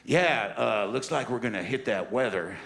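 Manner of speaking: a hint of a too-casual airline captain; cocky pilot. Pilot on the intercom telling passengers about the weather, casually cocky. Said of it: "this guy gets on and says".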